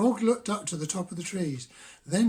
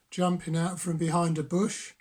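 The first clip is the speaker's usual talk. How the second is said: ordinary delivery, nothing marked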